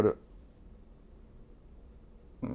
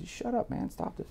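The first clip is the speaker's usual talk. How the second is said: retold hushed scolding; 12 semitones higher, 9 dB quieter than usual. Retold telling-off, kept down.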